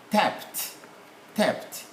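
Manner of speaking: "Tapped" ending in T, not D; final T sound. Shown as a demonstration. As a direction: say "tapped" as "tapt". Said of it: "T, not D".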